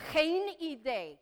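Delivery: "Geen idee" drawn out slowly; slow, careful "Geen idee" with a long first word; slow teaching model. Shown as a demonstration. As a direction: stretch "Geen idee" for learners to repeat. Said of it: "This is a little tougher".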